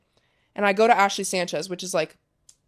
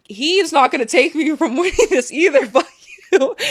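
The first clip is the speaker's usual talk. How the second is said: high, loud, laughing mock defiance; hammed-up defiance cracking into laughter; defiant outburst; 7 semitones higher, 6 dB louder than usual. Player vowing her dad won't spoil the win, mock-defiant.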